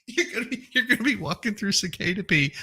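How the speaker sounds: high-pitched, laughing all through; cracking up. Real, not acted. Joking about walking through cicada pee, cracking up. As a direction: squeeze the words out between real giggles.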